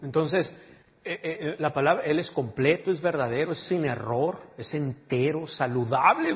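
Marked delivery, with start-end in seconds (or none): none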